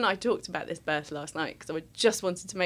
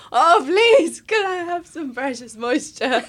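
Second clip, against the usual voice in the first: mock pleading; 8 semitones higher, 6 dB louder than usual.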